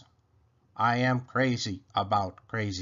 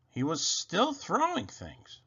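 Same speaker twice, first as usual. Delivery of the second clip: plain reading voice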